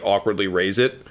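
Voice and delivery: ordinary talk, nothing marked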